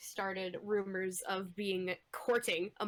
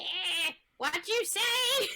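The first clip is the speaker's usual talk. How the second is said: put-on old person voice; 7 semitones higher, much slower than usual. Deaf old person joke.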